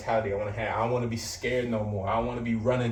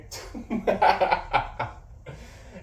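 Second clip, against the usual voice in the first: high wheezing laugh; 8 semitones higher than usual. Real, not acted. Laughs at own story.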